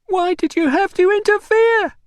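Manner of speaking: high, loud, anguished shriek; accusing shriek. A narrator's reading. Mother blaming visitor for interfering, shrieking. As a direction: shriek the question at him. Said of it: "she shrieked".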